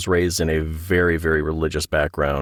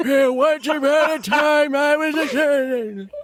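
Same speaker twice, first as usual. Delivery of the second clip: very high, slow, garbled storytelling; hammed-up garbled nursing home voice, drawn out; mock unintelligible rambler; much slower than usual. Nursing home podcaster telling a tale, mock unintelligible rambling. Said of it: "nobody can understand what we're saying".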